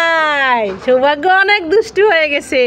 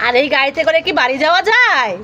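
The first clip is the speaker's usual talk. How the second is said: ordinary delivery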